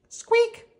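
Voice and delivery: high squeaky toy noise; toy squeak. Acted.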